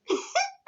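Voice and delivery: very high giggle; real squealing laugh; high squeal of laughter. Real, not acted. Laughs at the story.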